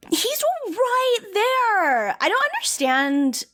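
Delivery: high, drawn-out mock wail; hammed-up outrage, every word dragged out; mock exasperation. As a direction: stretch "right there" out in high, incredulous protest. Mock outrage over [name] pick.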